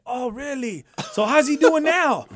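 high, loud feigned curiosity; playing dumb, bright and eager; mock innocent. Mock innocent question again.

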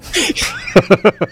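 burst of laughter. Real, not acted.

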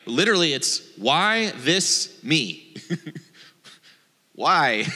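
giggling through the words; amused, laughing at the odd literal phrase; chuckling while translating. Real, not acted.

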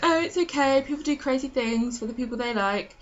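lightly voiced reassurance, pitched high; Sophie. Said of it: "and she's like".

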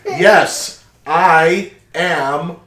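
slow, loud and clear. Acted. Speaking slowly for deaf shopkeeper.

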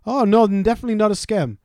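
high-pitched mock denial; protesting scam caller. Scammer denies the scam to customer, high mock protest. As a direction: imitate a phone scammer protesting innocence.

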